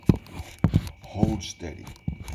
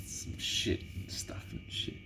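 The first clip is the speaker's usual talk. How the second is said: whisper; 12 semitones lower, 10 dB quieter than usual. Real, not acted. Muttering aside to himself.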